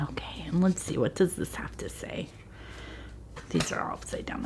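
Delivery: soft unvoiced whisper. Real, not acted. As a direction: whisper it to yourself. Whispering to herself over cards.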